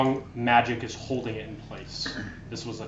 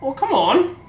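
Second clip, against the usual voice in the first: exasperated whine; 14 semitones higher, 11 dB louder than usual. Acted. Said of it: "she's like".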